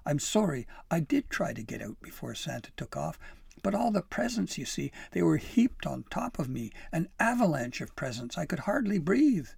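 loud, flustered excuse; pleading. A narrator's reading. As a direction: plead your case urgently, pitch jumping up. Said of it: "Pim pleaded".

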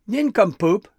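outraged, very loud high shriek. A narrator's reading. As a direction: shriek the insult back in outrage. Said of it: "Gristle shrieked".

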